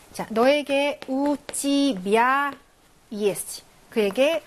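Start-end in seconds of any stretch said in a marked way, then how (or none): 1.1-3.6 우찌비아 drawn out syllable by syllable; slow model pronunciation. Shown as a demonstration. Teacher giving "to you" in Russian, slow model pronunciation. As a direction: stretch each vowel for learners to follow.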